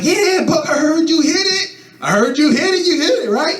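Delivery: high mocking hype voice; teasing friends. Friends teasing him in front of her, high mock hype. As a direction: egg him on, squeaky high.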